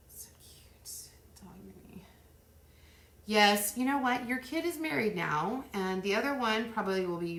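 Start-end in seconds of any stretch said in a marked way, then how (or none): 0.1-2.0 soft whispered aside. Real, not acted. Vlogger reacting to the dog movie, whispered. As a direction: hush it to yourself.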